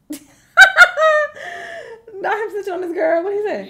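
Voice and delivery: high-pitched incredulous reaction; amused disbelief. Real, not acted.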